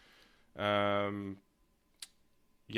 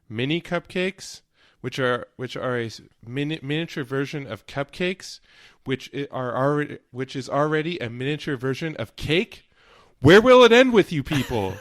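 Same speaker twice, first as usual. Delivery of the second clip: quoting Kevin; 4 semitones higher, 6 dB louder than usual. Retold or imitated. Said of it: "Kevin the accountant goes".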